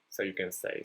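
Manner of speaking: ordinary talk, nothing marked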